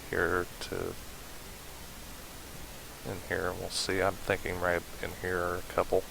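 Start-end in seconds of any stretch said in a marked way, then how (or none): none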